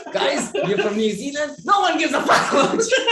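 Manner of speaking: high, loud comedy-gig shout; playful outburst, rising to a yell; mock stage heckle. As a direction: pretend to yell it at an audience, half laughing. Acting out next comedy gig bit.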